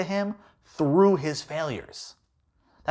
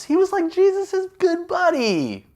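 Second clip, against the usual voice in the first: pitched way up, teasing; high mock-cute aside; high playful aside; 3 dB louder than usual. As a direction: squeak it out like a joke. Playful aside about Peter.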